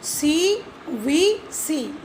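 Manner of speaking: सी वी सी said very slowly, letter by letter; teacher dictating सी वी सी at a slow pace; slow spelled-out teaching. Shown as a demonstration. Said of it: "syllable का structure ये होता है".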